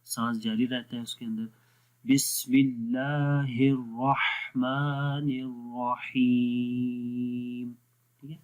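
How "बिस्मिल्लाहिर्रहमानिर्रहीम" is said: slow बिस्मिल्लाहिर्रहमानिर्रहीम, breath flowing on ह; recitation demo. Shown as a demonstration. Teacher recites Bismillah to show breath in the ह. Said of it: "हा के अंदर भी ये हम्स की क्वालिटी".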